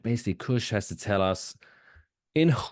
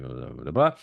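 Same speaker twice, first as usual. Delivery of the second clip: ordinary delivery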